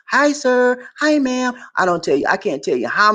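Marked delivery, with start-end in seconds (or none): 0.1-1.5 high, bright-voiced clerk greeting, much higher; imitating shop staff. Store clerk greeting a foreign customer, high and sunny. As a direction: chirp it like a cheerful store clerk. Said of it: "their way of welcoming you".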